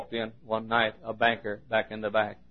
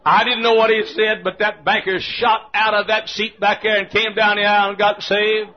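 high, loud excited shouting; full-force preaching; 10 semitones higher, 11 dB louder than usual. Real, not acted. Preacher telling how the banker got saved, excited shouting. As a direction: shout the triumphant outcome to the crowd.

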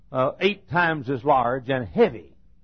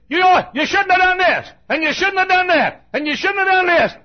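high, loud scolding daddy; angry father scolding; 8 dB louder than usual. Retold or imitated. Daddy scolding his daughter, hammed-up loud rebuke. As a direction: shout it like a furious dad.

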